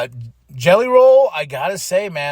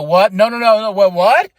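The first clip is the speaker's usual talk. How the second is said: louder, rising no no no; excited disbelief; 4 semitones higher, 7 dB louder than usual. Retold or imitated. Fan reliving the cash in, loud and rising. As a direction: pile up the no's in excited shock.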